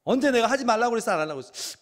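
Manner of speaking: loud, high, fast furious scolding; angry parent. Retold or imitated. Parent scolding the child, furious rapid questions. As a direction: blow up at the child. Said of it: "이러면 더 화가 나잖아요".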